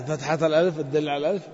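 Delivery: usual speaking voice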